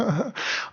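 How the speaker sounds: a short high burst of laughter. Real, not acted. Laughing at his friends' cocaine joke. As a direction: break into a quick high laugh.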